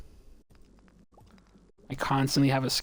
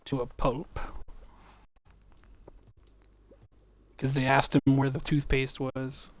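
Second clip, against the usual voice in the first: mock news headline; slower than usual.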